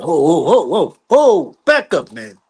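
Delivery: loud, high whoas cutting him off; retold warning to back off; mean demeanor. Joe Jackson stopping the visitor, mean and loud. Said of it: "he got a little mean demeanor to him".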